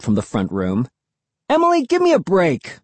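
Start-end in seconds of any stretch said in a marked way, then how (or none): 1.5-2.8 high, loud exasperated shout. A narrator's reading. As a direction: shout it at your sister. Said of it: "I shouted".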